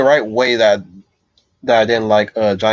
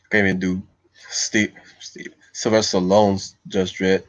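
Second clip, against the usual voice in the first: usual speaking voice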